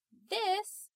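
high, loud shout. A narrator's reading. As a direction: shout it out as one word. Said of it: "Donna shouted at the door".

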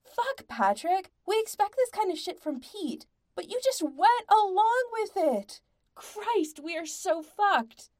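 high, loud, furious tirade; enraged. A narrator's reading. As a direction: yell it in uncharacteristic rage. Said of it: "in an uncharacteristic rage".

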